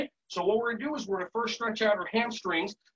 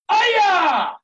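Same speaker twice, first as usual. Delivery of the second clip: loud, high karate cry; explosive "aya" battle cry; martial arts yell; 12 semitones higher, 12 dB louder than usual. Shown as a demonstration. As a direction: let out a piercing kiai. Martial arts shout as song chorus.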